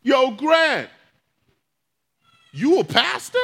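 called out loud and high; a patient shouting to his neighbour behind the curtain; shouting across the room. Retold or imitated. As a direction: yell it across the ward to the man in the next bed. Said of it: "Kevin shouts out".

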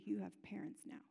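put-on soft whisper to the child; mother whispers comfort to her son in hospital; whispered reassurance. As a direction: breathe it to him gently.

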